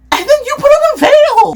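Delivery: high-pitched mock-outraged yell; exasperated outburst. Real, not acted. Vlogger roasting a funeral outfit, exasperated shout. Mocking outburst over the veil.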